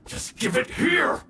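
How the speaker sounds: high, sharp shout; angry demand. Acted. Villain demanding the charm back, angry shout. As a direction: snap it as an order.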